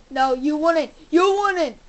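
loud, rising challenge; defiant shout. Real, not acted. Player confronting a zombie in the game, loud and defiant.

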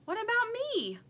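high, different put-on voice; Stingray's voice. A narrator's reading. Stingray wanting attention from Lumpy, high plaintive voice. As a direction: pout for attention.

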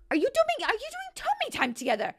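high, loud and fast disbelief; can't believe what she's seeing; incredulous shriek. Real, not acted. Shocked at sims' behaviour.

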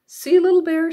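high bright call to her cub; excited mother bear. Acted. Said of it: "she said".

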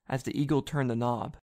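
ordinary delivery

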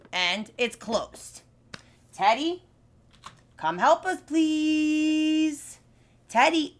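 ordinary delivery

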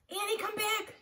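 put-on boy shouting after his sister. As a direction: shout for her to come back. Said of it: "Jack shouted".

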